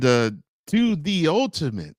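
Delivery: ordinary talk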